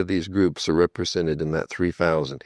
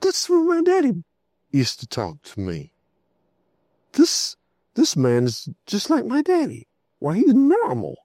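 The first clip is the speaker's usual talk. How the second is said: put-on amazed boy's thought. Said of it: "the kid thinks to himself".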